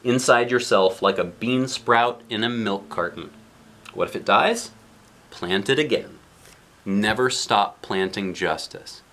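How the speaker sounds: ordinary delivery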